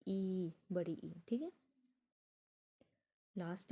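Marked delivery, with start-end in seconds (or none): 0.1-1.1 ई, बड़ी ई said slowly with ई held; slow demo. Shown as a demonstration. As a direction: draw out the long ई for the class. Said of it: "इसका example है हमारा".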